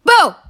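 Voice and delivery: loud high shout of boo; startling boo. Pretend. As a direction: jump out and yell it. Jump scare for listeners.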